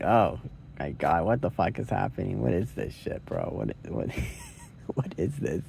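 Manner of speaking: hushed, low retold disbelief; stunned and lost. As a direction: quietly imitate her stunned confusion. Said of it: "completely stunned and lost".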